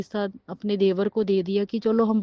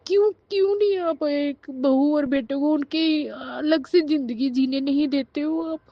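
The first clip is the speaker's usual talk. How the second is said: high, anguished pleading voice; real tearful protest; 4 semitones higher than usual. Real, not acted. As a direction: plead on behalf of every wronged bride. Tearful plea for daughters-in-law. (रुआँसी, ऊँची आवाज़ में गुहार)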